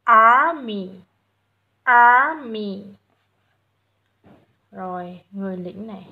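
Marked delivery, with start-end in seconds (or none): 0.0-2.8 "Army" very loud, first vowel stretched, then dropping; long A. Shown as a demonstration. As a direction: hold the opening "ar" of "Army" long and strong. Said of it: "Ai dài này?". ("Army" đọc thật to, kéo dài âm a)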